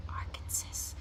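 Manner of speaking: whispered Arkansas ending in a sounded S, AR-kən-sis; furtive whisper of Arkansas as AR-kən-sis; wrong pronunciation. Shown as a demonstration. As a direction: whisper Arkansas with the final S pronounced. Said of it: "say it wrong 'cause it looks like".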